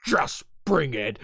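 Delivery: slow, put-on final boss voice; drawn-out cocky dare, split in two; taunting challenge. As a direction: imitate a wrestler's challenge. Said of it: "like, taunting Vegeta".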